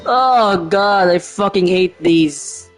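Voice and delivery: usual speaking voice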